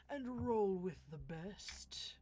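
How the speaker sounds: put-on slow old man voice. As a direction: drag it out like an old-timer. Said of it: "I have to do an old man voice".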